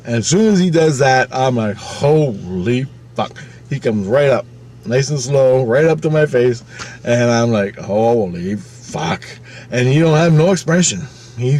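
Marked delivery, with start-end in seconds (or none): 1.8-3.4 very drawn-out, stunned exclamation; slow-motion mock astonishment; retold awe. Stunned reaction acted in retelling. 7.8-9.2 long, stretched-out stunned exclamation; slowly savored shock; retold awe. Stunned reaction acted, repeated.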